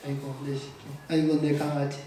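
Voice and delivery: low, softening grandma's fond coo; doting grandmother. Retold or imitated. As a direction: coo like a grandma over a baby. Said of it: "할머니가 이렇게 얘기한 걸". (낮고 다정한 할머니 말투)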